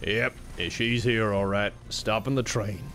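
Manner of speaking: cowboy outlaw. Pretend. Outlaw readying the boys for a train job, cowboy voice. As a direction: keep it low and steady for the gang.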